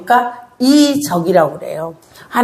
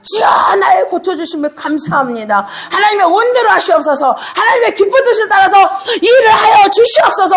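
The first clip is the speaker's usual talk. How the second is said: raised, higher ardent prayer voice; fervent prayer; 10 semitones higher than usual. Acted. Pastor modelling a grateful prayer to the congregation, fervent.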